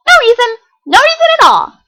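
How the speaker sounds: very high, loud innocent denial; sly bubbly denial. Acted. Pinkie's sly grin.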